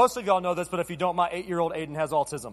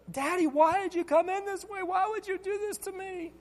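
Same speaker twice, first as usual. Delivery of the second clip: put-on high kid voice, fading much softer; little boy's lament; 10 semitones higher, 5 dB quieter than usual. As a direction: plead like a wounded child. Son complaining about entrance.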